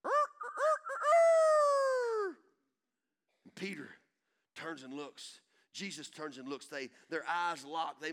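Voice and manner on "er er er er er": very high, loud cock-a-doodle imitation; preacher imitating the rooster crowing loudly. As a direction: crow like a rooster at dawn. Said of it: "The rooster goes".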